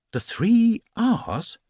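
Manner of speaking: high, slow bewildered echo; puzzled surprise. Acted. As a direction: repeat it back, baffled. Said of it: "repeated Paddington in surprise".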